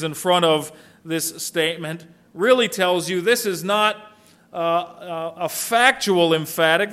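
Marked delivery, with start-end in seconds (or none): none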